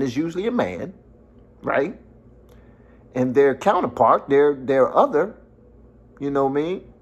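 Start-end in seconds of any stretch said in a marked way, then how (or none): none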